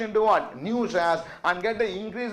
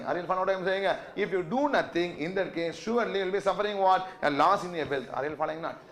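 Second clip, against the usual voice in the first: ordinary talk, nothing marked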